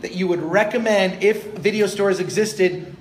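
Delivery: usual speaking voice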